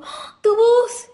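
loud, high, drawn-out mock astonishment; imitated startled gasp. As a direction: mimic someone shocked by a voice. Imitates ticket girl's startled reaction. (sorpresa exagerada, aguda y fuerte)

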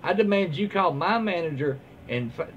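ordinary talk

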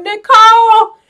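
high, loud mock call for a lost friend; mock search party calling Nicole. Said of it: "He's walking around going".

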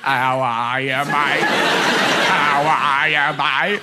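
low, slow machine impression; slovenly talking machine. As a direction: slur it like a matey Australian machine. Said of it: "in a slovenly way".

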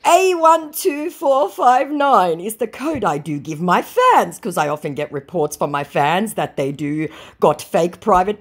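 scammer's pasted notice, read in a mocking high voice. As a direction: ham up the scammer's official warning. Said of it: "he copy-pastes".

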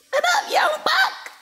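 very high, drawn-out falsetto; tiny falsetto voice; squeaky greeting. Pretend. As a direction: greet them in a playful squeak, slowly.

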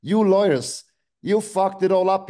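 real anger, hurled at them; angry shout. Real, not acted. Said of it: "I'm angry now".

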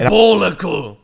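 Diabolical! barked loud with falling tune; Billy Butcher impression. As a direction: punch out Diabolical! like a TV tough guy. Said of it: "I hear Billy Butcher every time I hear that word".

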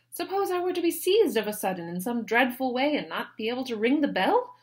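high, loud fretful lady; Mary fretting. A narrator's reading. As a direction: paint the dreadful scene with alarm. Mary frets dramatically.

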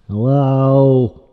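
loud, level attention call. Pretend. Trying to get Mister Dude's attention, loud call. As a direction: call out firmly to someone ignoring you.

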